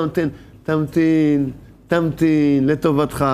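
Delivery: a slow, tender plea to be patient; gentle pleading. Retold or imitated. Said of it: "מתחננים אליו".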